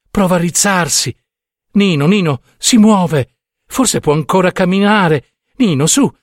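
high, urgent, horrified exclamations; agitated urging. A narrator's reading. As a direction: urge him to look and help. Wife urgently pointing at crows and horse.

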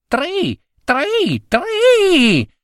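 very high chirping tri tri tri; cricket trill. Acted. Cricket answering the husband with a high trill.

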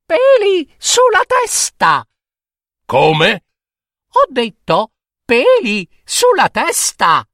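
very high mocking child voice with giggles; high sing-song teasing rhyme; teasing little girl. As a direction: taunt the monster cheekily. Lucilla teasing the monster in rhyme.

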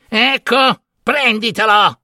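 high, grudging hag voice; exasperated hag. A narrator's reading. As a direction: hand it over huffily in a high voice. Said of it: "con un sospiro e disse".

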